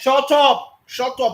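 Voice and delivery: furious shout of shut up, loud and high; angry yell. Real, not acted. Angry caller shouting down the panelist, furious. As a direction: bark him into silence.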